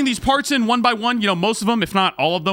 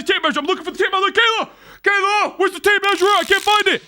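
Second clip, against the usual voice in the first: calling out frantic; 11 semitones higher than usual. Retold or imitated. Calling to wife, retold.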